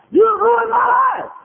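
very high, loud, drawn-out shriek; screamed mock accusation. Lady accusing the preacher in his office, full shriek. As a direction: scream it slowly as the wronged lady.

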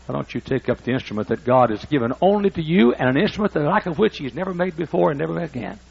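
usual speaking voice

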